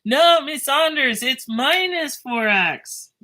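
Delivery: high, loud mock yell from the class, slow; students yelling correction. Students yelling at the teacher through the screen, mock loud shout. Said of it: "you guys were probably yelling at the screen".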